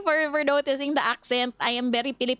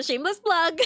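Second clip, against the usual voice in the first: laughing through it, pitched up; 9 semitones higher than usual. Real, not acted. Streamer laughing at her own plug to viewers. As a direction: giggle out the words, embarrassed.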